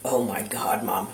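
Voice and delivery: retold gasp in a hushed whisper to her mother. Mother reliving finding the vodka, whispering in dismay. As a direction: breathe it out, almost no voice. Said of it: "And I said".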